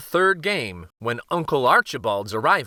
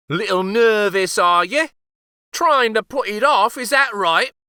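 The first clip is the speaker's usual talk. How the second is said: much higher, loud chuckling tease; teasing old uncle; 9 semitones higher, 7 dB louder than usual. Acted. Uncle to the nervous king, high teasing old man. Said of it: "asked Uncle Archibald".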